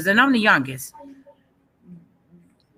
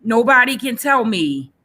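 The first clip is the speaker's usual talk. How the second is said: ordinary delivery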